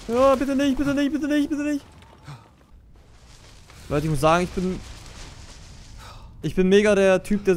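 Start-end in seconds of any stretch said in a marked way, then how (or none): 0.1-1.9 scared pleading. Real, not acted. Scared streamer pleading as something approaches. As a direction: beg the game to spare you.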